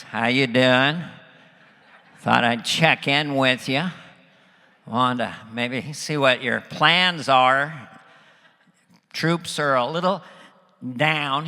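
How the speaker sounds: chummy presidential impression, low and slow; George Bush. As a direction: check in like a folksy president, drawing words out. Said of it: "Like we're friends".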